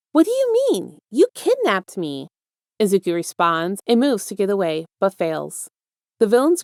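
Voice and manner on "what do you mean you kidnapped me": very high opening, slowing after; incredulous protest. A narrator's reading. Boy confronts his kidnapper, incredulous. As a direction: protest in high-pitched disbelief, then drag it out.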